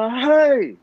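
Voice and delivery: put-on sharp, high, loud "Hai." snapped at the child; a frustrated father yells "Hai." at his crying son; angry shout. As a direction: bark "Hai." at the crying toddler. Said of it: "Saya kata".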